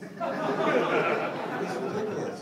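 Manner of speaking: laughing it out, high and loud; can barely get it out for laughing. Real, not acted. Comedian cracking up at critics, laughing hard.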